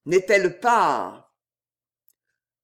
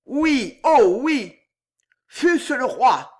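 higher, louder, building to the king; rising fervour; 6 semitones higher, 5 dB louder than usual. Acted. Détiole urges Damiens to kill any intruder, fervently. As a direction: drive it home with mounting zeal. Master orders murder, rising intensity.

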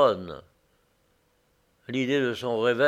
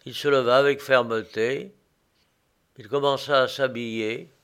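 plain reading voice

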